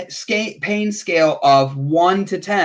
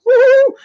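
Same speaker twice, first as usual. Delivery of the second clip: a high whooping woo, much louder; mock woo; 9 dB louder than usual. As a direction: whoop like the edible just hit. Mock reaction to too many edibles.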